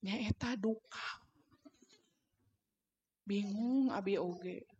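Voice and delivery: soft, slow, subdued answer; hushed little admission; acted child's reply. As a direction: play a confused child answering under the breath.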